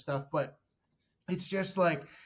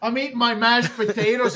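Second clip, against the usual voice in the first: loud, higher mock complaint; mock annoyed; 6 semitones higher, 10 dB louder than usual. Comic at a dinner table, mock annoyed. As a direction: play up the irritation.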